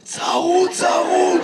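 mock raspy voice; imitated hoarseness. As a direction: mimic a hoarse man straining to speak. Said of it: "למה אתה נשמע ככה".

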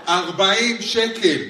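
slow, drawn-out shock; slow stunned reading of the price. Acted. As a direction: read the price in disbelief.

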